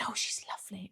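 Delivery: unvoiced whisper. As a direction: whisper it behind a hand. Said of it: "And she said".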